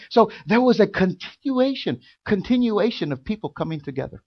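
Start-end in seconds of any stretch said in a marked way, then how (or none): none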